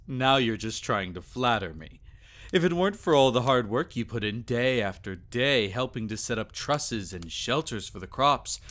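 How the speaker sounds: usual speaking voice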